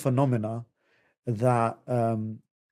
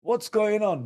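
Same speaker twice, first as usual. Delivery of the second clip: mock alarm; 6 semitones higher, slower than usual. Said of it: "they're like".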